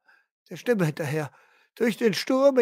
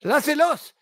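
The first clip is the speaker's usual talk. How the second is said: shout; 7 semitones higher than usual. Acted. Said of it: "Ich schreie".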